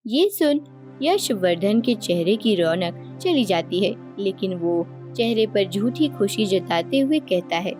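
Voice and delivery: ordinary delivery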